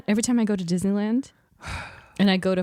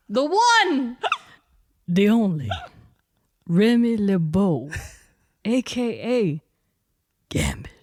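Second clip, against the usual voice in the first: dramatic announcer reveal; 2 semitones lower, 3 dB louder than usual. Pretend. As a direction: announce grandly, pausing before each title. Dramatic announcer reveal, dropping to name.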